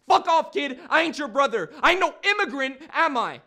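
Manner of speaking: high, very loud denial; angry shout. Acted. Nigel denying he is brother or immigrant, angry shout. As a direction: yell him off with full force.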